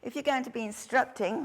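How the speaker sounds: ordinary talk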